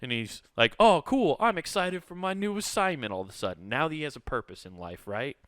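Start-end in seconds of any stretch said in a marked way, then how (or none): 0.8-3.7 mock excited. Excited document character.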